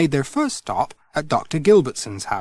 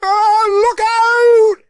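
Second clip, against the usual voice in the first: much higher and louder, drawn-out slow yell; shouted warning; 10 dB louder, much slower than usual. Acted. Alf yells down from the ladder at Pat, loud warning. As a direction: warn him before the tin falls. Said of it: "when Alf shouted".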